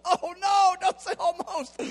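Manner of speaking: high, pleading whine; mock plea. Pleads with God, joking.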